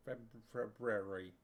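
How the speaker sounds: February started twice, Feb- then the full word; stumbling February. Shown as a demonstration. Host greeting viewers, stumbles on "February" on purpose. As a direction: trip on "Feb-" and restart "February". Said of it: "We had this conversation already".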